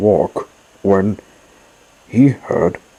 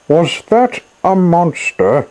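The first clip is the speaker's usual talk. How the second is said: much higher, louder call to the crocodiles; 10 semitones higher, 4 dB louder than usual. Acted. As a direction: shout the question after them as they flee. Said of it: "Elmer called out to the crocodiles".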